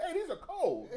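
high squeaky voice for the cold fries; high-pitched mock disappointment; mock complaint. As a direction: squeal it like a let-down customer. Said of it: "you're like".

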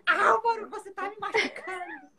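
high, strained complaint; pained outcry. Retold or imitated. Mother in pain telling her son it hurts, strained outcry. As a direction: cry it out in pain.

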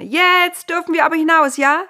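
high, loud, impatient cry; eager shout. Acted. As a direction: burst out like kids who can't wait. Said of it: "riefen Noahs Söhne".

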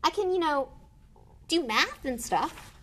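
put-on high, defensive boy's voice; protesting boy. A narrator's reading.

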